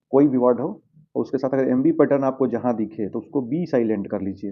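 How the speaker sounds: ordinary talk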